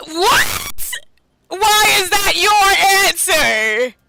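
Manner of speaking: high, indignant protest; incredulous yelling. Real, not acted. Streamer objecting to a game character's answer, incredulous yell. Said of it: "I'm just yelling".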